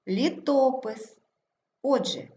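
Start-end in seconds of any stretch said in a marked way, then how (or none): 0.1-1.2 Літопис slowly, stressed лі-ТО-пис. Shown as a demonstration. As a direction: stretch ТО in Літопис. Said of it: "наголос падає на О". (Літопис повільно, з наголосом на ТО)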